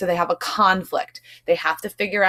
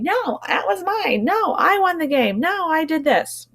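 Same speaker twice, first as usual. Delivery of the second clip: put-on high, insistent bickering child; arguing kids; 9 semitones higher than usual. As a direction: play a kid fighting over a game.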